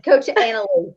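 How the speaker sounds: Southern accent on the name. Shown as a demonstration. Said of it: "In the Southern accent".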